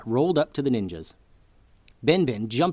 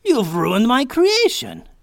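high, loud, slow anguished wail; wailing mad scientist; 12 semitones higher, 8 dB louder, slower than usual. A narrator's reading. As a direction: lament the ruined creation. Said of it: "man with wild hair".

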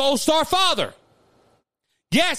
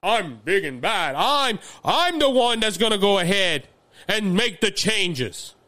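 lightly voiced big shot puffing himself up; mock boastful politician; 6 semitones lower than usual. Host mocking boastful Republicans with a slow lower swagger. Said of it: "there is Republicans that".

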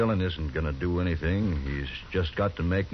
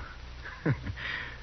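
short laugh; 5 semitones higher, 9 dB quieter than usual. Acted. Bissell greets marshal with laugh.

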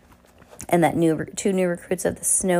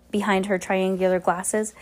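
plain reading voice

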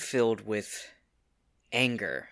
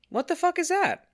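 much higher, loud disbelieving cry; exasperated outcry; 5 dB louder than usual. Acted. Stressed hero venting about his ruined life, exasperated cry. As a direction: throw up your hands in despair.

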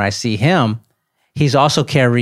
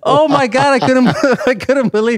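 stunned excited disbelief; 10 semitones higher than usual. Real, not acted. Telling his friend the stunning punchline, high and laughing. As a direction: voice jumps up, laughing in shock. Stunned by the old man's reply.

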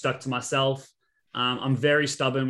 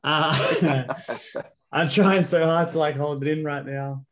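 laughing through tears; 3 semitones higher, 3 dB louder, slower than usual. Real, not acted. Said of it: "making me cry".

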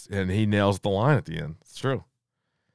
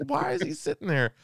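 ungläubige Frage, höher, durch Lachen; laughing disbelief; 4 dB quieter than usual. Retold or imitated. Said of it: "I was like".

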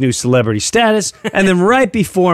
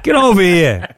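put-on Italian accent; 4 semitones higher than usual. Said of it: "with his heavy Italian accent".

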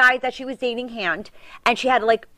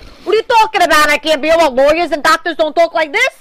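loud, raised New York protest; loud New Yorker accent; 4 semitones higher, 6 dB louder than usual. Retold or imitated. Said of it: "your accent, you can only be stereotyped as".

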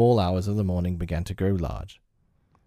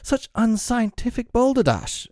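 very high, indignant outburst; theatrical, pitched-up outrage; flash of temper; 4 dB louder than usual. A narrator's reading. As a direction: splutter it as an affronted gentleman scientist. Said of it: "This little spirit of temper".

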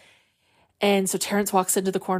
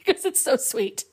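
high-pitched tender whimper, mock tearful; choked up; 10 semitones higher than usual. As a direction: act touched to the point of tears. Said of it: "I always choke up".

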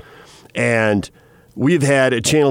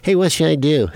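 lightly put-on goofy character voice; Snickledorf; 3 semitones higher than usual. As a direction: ham it up a little as a comic character. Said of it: "my best Snickledorf voice".

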